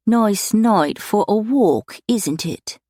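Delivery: a thick, plummy Londoner greeting the stranger; thick plummy London accent. A narrator's reading. Stranger in the dark greeting Alistair, plummy London accent. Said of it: "said a man's voice, thick, plummy, with an unmistakable London accent".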